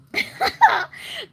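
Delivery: high laugh. Real, not acted. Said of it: "laughs at uptight people".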